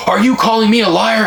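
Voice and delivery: high, offended mock challenge; imitating an outraged salesman; indignant salesman. Mimicking the salesman demanding if he's called a liar, mock indignant. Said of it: "did go".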